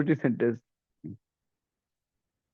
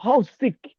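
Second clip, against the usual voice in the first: higher mock disgust; 7 semitones higher than usual. Shown as a demonstration. Teacher showing disgust in an example. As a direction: sneer at it.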